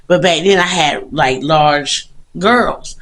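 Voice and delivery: ordinary delivery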